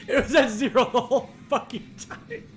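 ordinary talk

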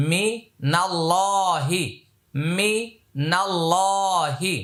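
মিনাল্লাহি drawn slowly, the alif and লাম skipped; slow careful মিনাল্লাহি. Shown as a demonstration. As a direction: say মিনাল্লাহি slowly, no আলিফ sound. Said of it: "এই আলিফটা লামটা পড়তে হবে না". (মিনাল্লাহি ধীরে, আলিফ বাদ দিয়ে)

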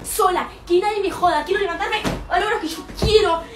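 high, eager run of lines as she leaves; gleeful escape. Acted. Daughter telling her friend she is moving out, eager and high. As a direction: sing out your freedom to a friend.

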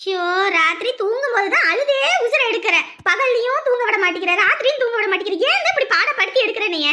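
loud, high-pitched sleepless outburst; exasperated scolding. Acted. Tired woman to the child crying at night, exasperated scolding.

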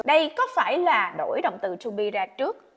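normal talking voice

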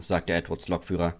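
ordinary delivery, nothing marked

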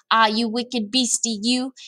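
slow storybook scolding; mildly dramatic reproach; scolding cry. A narrator's reading. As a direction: draw it out, scolding the creature. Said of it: "he cried".

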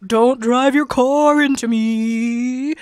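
slow, loud, goofy moose voice, words dragged out; moose impression. Streamer doing a follow-goal moose impression for chat, slow, loud and silly. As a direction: ham up a talking moose for the chat. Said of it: "for the moose impression?".

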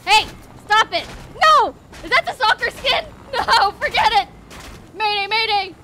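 frantic mayday cries; panicked yelling. Real, not acted. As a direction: scream for it to stop. Being shot in the helicopter.

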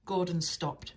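usual speaking voice

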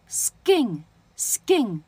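skin as "sgin", slow and spaced; unaspirated k. Shown as a demonstration. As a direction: model skin with k turned to g, no puff. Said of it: "什么叫不送气呢".

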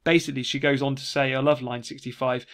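ordinary talk, nothing marked

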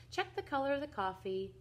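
plain reading voice

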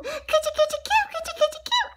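very high, squeaky coochy-coo; baby-talk cooing. Acted. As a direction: coo rapidly as if tickling a pet. Cooing over the donut pet.

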